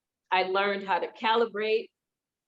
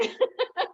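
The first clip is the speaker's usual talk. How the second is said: a sudden, very high laugh. Real, not acted. Laughs recalling tragedy striking.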